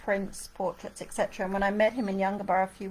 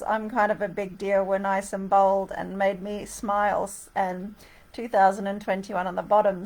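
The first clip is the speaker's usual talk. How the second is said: ordinary talk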